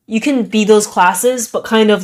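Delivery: ordinary delivery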